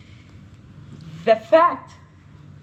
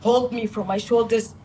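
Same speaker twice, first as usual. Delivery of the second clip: ordinary delivery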